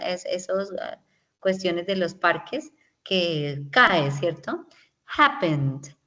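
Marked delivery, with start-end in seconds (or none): 5.2-5.9 HAP-pened, downhill; stress on first syllable. Shown as a demonstration. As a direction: hit HAP hard, let Happened slide down. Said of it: "empieza desde arriba y va bajando".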